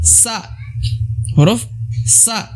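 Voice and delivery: sa, sounded out slowly; letter sa. Shown as a demonstration. Teacher modelling sa for the class. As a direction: draw out the letter sa. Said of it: "Kemudian huruf".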